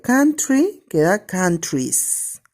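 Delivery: country and countries said slowly, endings clear; teacher slowly modelling the y to ies plural; slow demo of plurals. Shown as a demonstration. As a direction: draw out country and countries so students hear the change. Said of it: "Por ejemplo".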